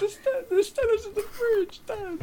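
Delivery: put-on squeaky whimpering kid; high crying child. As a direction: beg through fake tears, very high. Acted child crying to dad.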